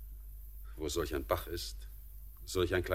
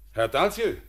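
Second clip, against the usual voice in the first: higher, louder call; raised hail across the yard; 9 semitones higher, 7 dB louder than usual. Acted. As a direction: call him over to the garden. Calling the master again.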